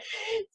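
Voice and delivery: short high laugh; a bright burst of laughter; quick real laugh. Real, not acted. Host laughs after message.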